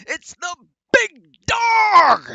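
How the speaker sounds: stretched, booming emphasis on every word; Michael Cole announcer call. Retold or imitated. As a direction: bellow it like a ringside announcer hyping the entrance. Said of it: "he says it like that".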